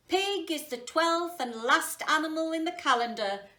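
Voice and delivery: loud royal declaration; declared. Acted. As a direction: declare it grandly. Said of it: "the Jade Emperor declared".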